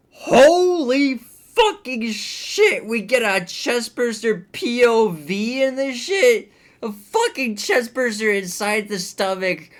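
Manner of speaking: bursting with gory delight; squealing with real glee; excited outburst. Real, not acted. Gushing over a gory scene.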